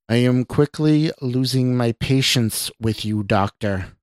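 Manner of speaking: ordinary delivery, nothing marked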